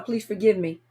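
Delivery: usual speaking voice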